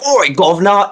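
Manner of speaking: hammed-up Cockney British greeting; mock British "Oi, governor" shouted up high; lightly exaggerated British accent; over-the-top British accent. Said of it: "over-the-top British accent".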